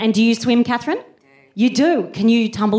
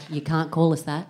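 hushed offended reply; 6 semitones lower, 6 dB quieter than usual. Retold or imitated. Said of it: "And I'm like".

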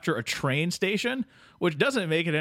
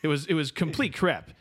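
normal talking voice

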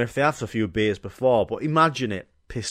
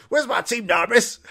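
high, louder coach's call; 10 semitones higher, 3 dB louder than usual. Acted. Coach entering the hall, calling much higher. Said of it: "He's like".

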